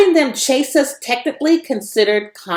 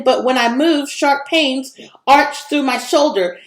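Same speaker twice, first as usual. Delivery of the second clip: ordinary delivery, nothing marked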